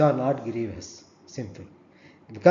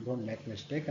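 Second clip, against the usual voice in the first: ordinary talk, nothing marked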